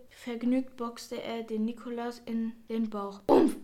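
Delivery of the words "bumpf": sudden loud grunt of impact; punched-belly oomph. A narrator's reading. Nikolaus boxed in the belly, loud grunt. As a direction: let out a loud "oof" as if hit. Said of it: "machte Nikolaus".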